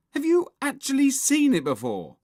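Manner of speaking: high, loud challenge; accusing hiss. A narrator's reading. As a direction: demand the truth from a friend. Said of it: "hissed Henry".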